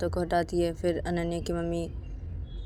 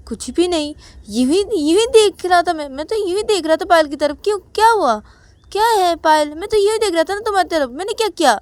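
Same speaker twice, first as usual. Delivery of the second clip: higher, louder innocent protest; defensive child; 12 semitones higher, 12 dB louder than usual. Acted. Ananya to mother, loudly protesting innocence. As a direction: play dumb, all innocence. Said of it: "तो, तो मैंने बोली".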